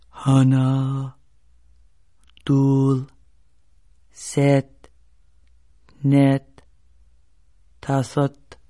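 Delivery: low, slow, measured creature voice counting in Korean; 거북이 목소리로 천천히 세기; slow turtle counting. Acted. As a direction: count each number deliberately like an old turtle.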